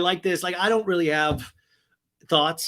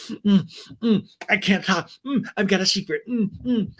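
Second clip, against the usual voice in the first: higher mumbled hums between words; exaggerated miming; 6 semitones higher, 3 dB louder than usual. Cast member drinking and hinting at a secret, exaggerated miming. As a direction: imitate someone showily keeping lips sealed. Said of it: "like Charlie Chaplin miming".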